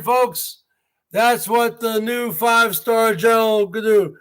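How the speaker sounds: ordinary talk, nothing marked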